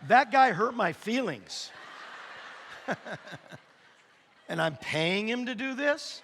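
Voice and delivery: loud, laughing fake wounded complaint; hammed-up wounded pride; mock hurt. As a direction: play the offended victim, laughing. Mock hurt punchline to congregation.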